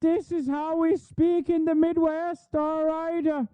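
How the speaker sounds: high, slow, soft parody of a folksy Michigander turned leprechaun; exaggerated mocking folksy voice; mock Yooper leprechaun. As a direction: ham it up as a fake Midwest accent sliding into Irish. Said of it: "I'm a leprechaun now".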